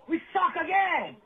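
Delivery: usual speaking voice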